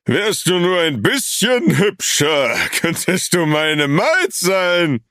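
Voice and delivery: höher und langsam, betrunken kichernd. A narrator's reading. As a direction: kichere anzüglich wie ein Betrunkener. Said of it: "kicherte Marcus". (betrunkenes Kichern)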